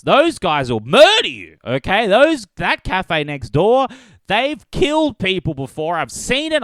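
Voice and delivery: mock warning. Exaggerated warning about cafe.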